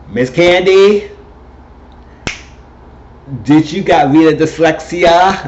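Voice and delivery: high, slow, lightly camped-up judge scolding a contestant; sassy judge voice. Retold or imitated. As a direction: draw it out like a diva judge mocking the dancer. Judge Prey Tell scolding Candy.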